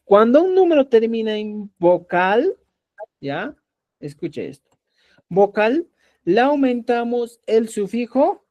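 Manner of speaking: ordinary delivery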